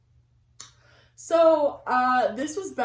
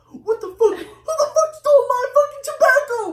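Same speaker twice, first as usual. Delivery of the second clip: high, loud mock raging grandpa; imitated angry rant; 14 semitones higher, 11 dB louder than usual. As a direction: build into a hammed-up outraged yell.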